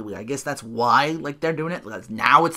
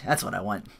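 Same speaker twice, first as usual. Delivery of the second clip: ordinary talk, nothing marked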